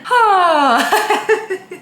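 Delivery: high, shaky sigh close to crying; a real sigh that catches in the throat; sighing as the tears come about grandma; shaky tearful sigh. Real, not acted. Grandma fell really unwell.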